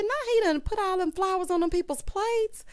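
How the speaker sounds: very high mock-outraged voice; high-pitched disbelief. Host reacting to petals on plates, high and incredulous. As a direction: squeal it in scandalized disbelief.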